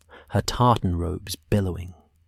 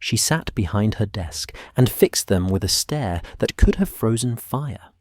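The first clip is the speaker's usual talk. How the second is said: ordinary delivery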